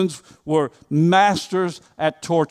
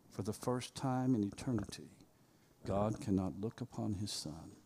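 barely voiced, breathy hush; hushed whisper; 7 semitones lower, 19 dB quieter than usual. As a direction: sink to a near whisper over the cross. Hushed crucifixion climax.

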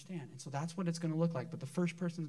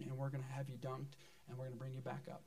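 ordinary talk, nothing marked

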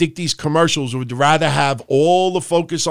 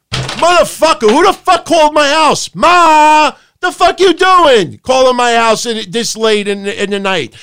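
much higher, much louder furious shout; furious yelling; 8 semitones higher, 7 dB louder than usual. Retold or imitated. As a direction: scream at the late caller like you were interrupted. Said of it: "And I'm like".

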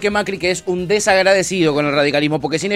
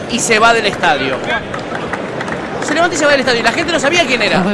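lightly hammed-up reporter read, higher pitch; mock sports reporter; 6 semitones higher than usual. Host presenting tennis scandal clip, mock reporter voice. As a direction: announce it like a gossip TV host.

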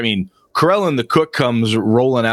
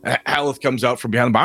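ordinary talk, nothing marked

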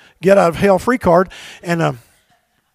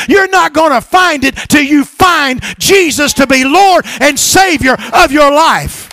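loud, higher, driving sermon; full-force preaching; 7 semitones higher, 4 dB louder than usual. Real, not acted. Pastor pressing the congregation to find Jesus, full-force preaching. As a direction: preach it hard to the pews.

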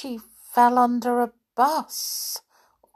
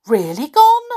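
surprised; 7 semitones higher, 5 dB louder than usual. A narrator's reading. Toys amazed she is gone.

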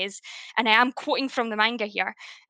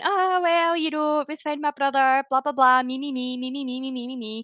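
mocking Orihime; 3 semitones higher than usual. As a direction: parody her as simpering and self-centred. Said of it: "Orihime starts going".

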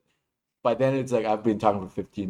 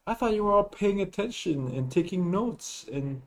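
higher-pitched mock surprise, teasing; sarcastic; 7 semitones higher than usual. Real, not acted. Teacher teasing students about online class, sarcastic. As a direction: feign innocent disbelief, dripping sarcasm. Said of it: "I hear the sarcasm in your voice".

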